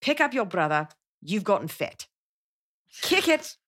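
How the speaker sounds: put-on South African parents scolding their kids; mock South African parent voice; South African accent. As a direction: imitate friends' South African parents nagging. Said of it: "And this is how they sounded".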